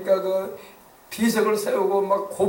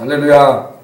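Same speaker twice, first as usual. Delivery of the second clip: loud hallelujah; 4 semitones lower, 12 dB louder than usual. Real, not acted. Preacher's shout of praise.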